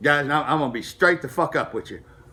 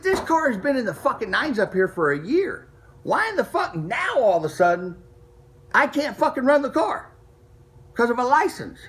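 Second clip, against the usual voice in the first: higher-pitched retold outrage, building in disbelief; indignant complaint; 7 semitones higher than usual. Retelling arguing with the track owner, high exasperated complaint. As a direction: protest the unfairness, pitch raised.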